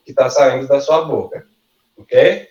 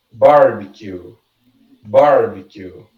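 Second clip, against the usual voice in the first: barbecue slow, with a strong BAR; first-syllable stress; 3 semitones lower, slower than usual. Shown as a demonstration. Teacher giving stress examples, barbecue stressed on BAR. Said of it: "a sílaba mais forte".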